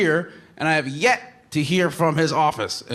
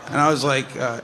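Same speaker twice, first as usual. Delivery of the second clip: normal talking voice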